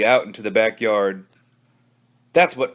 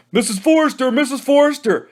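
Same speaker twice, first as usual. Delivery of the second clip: high tattling call; shouting; 12 semitones higher than usual. Acted. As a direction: shout for her at full lungs. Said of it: "shouted at the top of their lungs".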